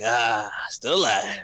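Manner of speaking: slow, groaned mock relief; weary mock-elderly groan; aching survivor. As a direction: drag it out like an aching body. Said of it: "every bone aching in your body".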